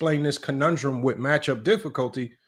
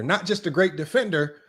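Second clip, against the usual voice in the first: usual speaking voice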